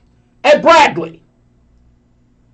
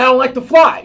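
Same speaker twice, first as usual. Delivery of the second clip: scared shout; 4 semitones higher, faster than usual. Retold or imitated. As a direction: blurt it out like a panicked passenger. Scared flyer answers steward.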